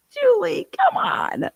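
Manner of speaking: very high squealing laugh. Real, not acted. Streamer laughing at Julie's chat message, high squeal. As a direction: squeal her name while cracking up.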